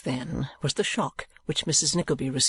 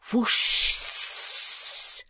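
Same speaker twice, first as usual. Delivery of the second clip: a long hiss through the teeth; hissed shoo sound; 9 semitones higher than usual. A narrator's reading. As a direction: hiss it like shooing a cat. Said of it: "uttered in a hissing manner between his teeth".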